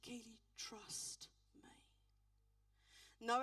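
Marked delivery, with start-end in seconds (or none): 0.0-1.7 slow, barely voiced whisper of the Spirit; still small voice. The Holy Spirit answering a grieving minister, slow whisper. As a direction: breathe it gently as God's quiet voice. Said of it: "the still, small voice".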